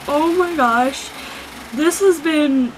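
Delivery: slow, choked-up voice on the edge of crying; real emotion, barely keeping it together; holding back tears. Real, not acted. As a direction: fight the tears as the words come out. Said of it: "you can hear my fucking tears in my voice".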